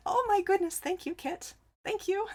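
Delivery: high, gushing real thanks; delighted thanks. Real, not acted. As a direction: squeal your gratitude up high. Touched by a viewer's compliment.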